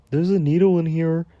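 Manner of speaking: high mimicry of the man's announcement; mocking quote. Storyteller throwing a man's words back at him, mockingly high. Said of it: "And you can talk about".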